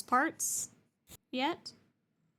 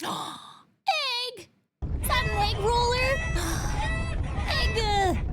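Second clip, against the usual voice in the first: high gasping delight; thrilled squeal of a name; excited gasp; 4 dB louder, much slower than usual. Real, not acted. Excited greeting of raider.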